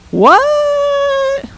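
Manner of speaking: high, loud disbelieving What?; a child who can't believe her ears; stunned what. Retold or imitated. Child stunned by odd advice.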